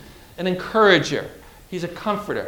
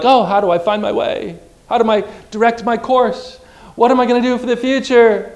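anxious worried voice; 6 semitones higher, 5 dB louder than usual. Retold or imitated. Preacher voicing anxious believer.

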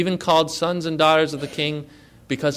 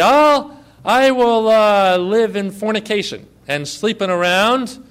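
mock careless sinner; 2 semitones higher, 4 dB louder than usual. Pastor mocks a churchgoer excusing sin, loud and smug. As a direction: play a smug sinner sure God will forgive.

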